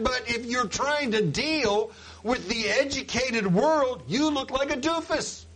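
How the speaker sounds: high, animated preaching; worked-up pulpit delivery. Acted.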